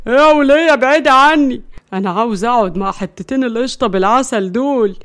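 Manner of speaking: stoned voice, loud then sinking; drugged protest. A narrator's reading. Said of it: "وهو تحت تأثير المخدر". (اعتراض واحد مسطول)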